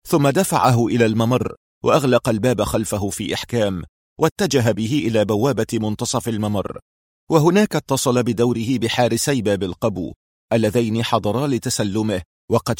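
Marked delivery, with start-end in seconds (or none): none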